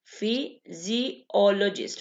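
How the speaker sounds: word stretched out slowly for learners; slow drill. Shown as a demonstration. Teacher slowly repeating a new word for students. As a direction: spell out physiologist slowly, syllable by syllable.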